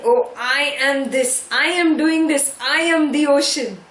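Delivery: a bubble shouting its own importance; mock bubble screaming. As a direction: puff up like a bragging bubble. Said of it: "If the bubble starts screaming".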